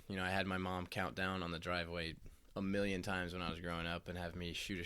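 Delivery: ordinary delivery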